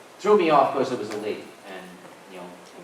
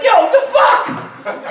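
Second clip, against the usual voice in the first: higher, louder, slow New York "Yo, the fuck?"; 12 dB louder, much slower than usual. Retold or imitated. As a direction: stretch it out like a tough New Yorker. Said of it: "He came up to one of our guys".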